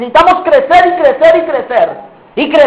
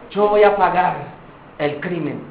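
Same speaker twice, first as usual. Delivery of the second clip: resigned answer; 7 semitones lower, 7 dB quieter than usual. Acted. Accused answers at trial.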